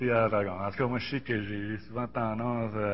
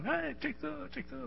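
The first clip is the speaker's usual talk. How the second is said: high, eager excited voice; 3 dB quieter than usual. Retold or imitated. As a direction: burst in all worked up with a new find. Retells himself showing off discoveries. (voix excitée, très aiguë)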